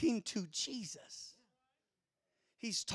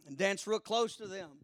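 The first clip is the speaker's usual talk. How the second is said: usual speaking voice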